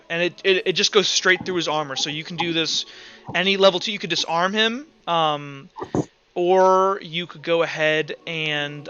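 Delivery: normal talking voice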